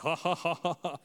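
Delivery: mock hearty ha-ha-ha; imitated laugh. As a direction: act out the reverend's amused laugh. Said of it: "And he starts laughing".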